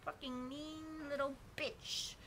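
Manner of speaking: put-on high, slow, sneering girl voice; mean girl voice. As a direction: spit it like a bratty mean girl.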